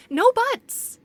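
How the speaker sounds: loud, high cutting "no"; indignant shout; sharp interruption. Acted. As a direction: shut the argument down hard. Investigator cuts off the argument.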